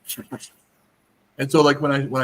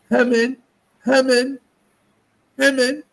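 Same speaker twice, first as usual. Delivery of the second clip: put-on high, louder little kid calling a name; toddler calling; 13 semitones higher, 6 dB louder, slower than usual. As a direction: call out sweetly like a two-year-old.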